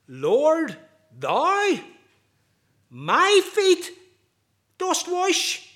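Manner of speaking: high, loud, drawn-out astonished words; each word lifted in stunned disbelief; utter astonishment. Retold or imitated. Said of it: "tone of utter astonishment and unbelief".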